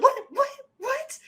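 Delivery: repeated high-pitched whats; baffled disbelief. Retold or imitated. Viewer reacting to the fighter jet scene, baffled disbelief. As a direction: squeak each what in mock bafflement.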